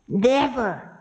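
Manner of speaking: high-pitched sharp refusal; high outraged rejection; indignant denial. Acted. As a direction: throw it back, pitched high. Time rejects Ravana's insult.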